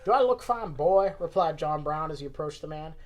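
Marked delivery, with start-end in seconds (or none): 0.0-1.1 put-on loud, raised Southern old-man retort; Southern accent. Old man rebuffs the guard he approaches, Southern accent. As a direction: answer loudly with a Southern twang. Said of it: "at least my Southern accent isn't horrible".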